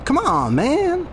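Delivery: high-pitched pushy buddy voicing a friend; high teasing voice. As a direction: wheedle like a cocky pal. Player voices a teasing buddy character.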